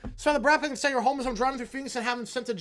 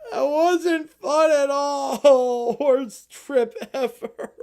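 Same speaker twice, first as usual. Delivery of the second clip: slow, high crying whine; crying kid; 3 semitones higher, 7 dB louder, much slower than usual. Acted. Kid back home, crying whine about the trip. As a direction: drag it out through tears.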